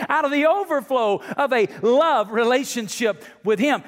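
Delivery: high, loud, driving preacher's cry; full-force preaching. Acted. Pastor pressing the congregation at full force. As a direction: preach it out, rising to a peak.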